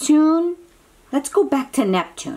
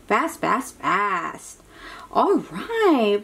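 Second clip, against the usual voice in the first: usual speaking voice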